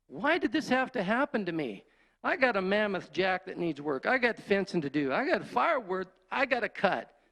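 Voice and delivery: high, pleading complaint; aggrieved, high-pitched outcry; self-pitying yell. Retold or imitated. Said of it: "I just yelled out".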